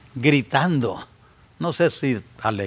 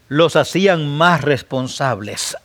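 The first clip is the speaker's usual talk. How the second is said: ordinary talk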